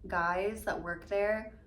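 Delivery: normal talking voice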